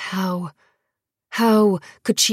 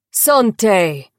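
toast cheer; 3 semitones higher, 5 dB louder than usual. A narrator's reading. Hall toasting the victor.

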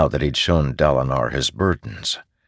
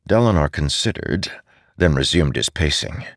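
plain reading voice

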